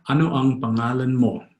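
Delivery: words run together without pauses; fast, native-style. Shown as a demonstration. Teacher showing how a Filipino would say it, fast and connected. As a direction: say "Ano ang pangalan mo" as one fluent phrase. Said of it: "Or fast, as a Filipino would say it".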